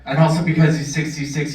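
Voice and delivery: usual speaking voice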